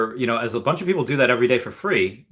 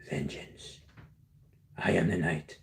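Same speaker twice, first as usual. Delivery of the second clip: whispered low Batman rasp, softer and lower; mock Batman voice; 5 semitones lower, 10 dB quieter, slower than usual. Streamer buying Vengeance comics, whispered mock Batman. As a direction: breathe it like a brooding caped hero.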